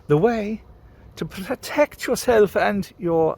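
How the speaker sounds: posh English accent, mock official. Shown as a demonstration. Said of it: "I can put on my posh voice".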